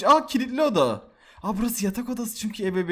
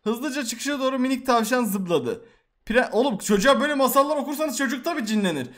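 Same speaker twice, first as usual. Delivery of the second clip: usual speaking voice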